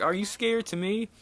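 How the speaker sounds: high-pitched imitated child; little kid. Little boy asking the rider a question, high child voice. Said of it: "the little kid, he's like".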